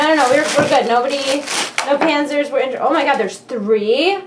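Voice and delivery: usual speaking voice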